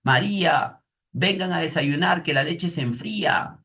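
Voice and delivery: a mum shouting up the stairs that breakfast is ready; calling out loudly. Acted. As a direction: call them to the table loud and clear. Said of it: "está en signos de admiración".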